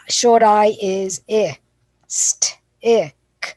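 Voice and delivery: I, st, I, k broken into sounds; sounding out slowly. Shown as a demonstration. Teacher sounding out stick, slow and deliberate. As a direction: segment the word slowly.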